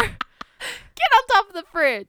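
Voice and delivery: slow, high, screeching cry; shrieking mock panic. Sister shrieks over reading.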